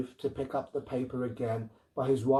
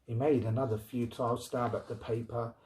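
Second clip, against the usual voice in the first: ordinary delivery, nothing marked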